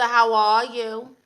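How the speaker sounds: ordinary talk, nothing marked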